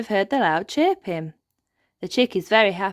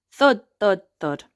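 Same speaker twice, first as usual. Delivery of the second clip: thud sounds; 3 semitones higher, 6 dB louder than usual. Acted. Everyone stamps really hard.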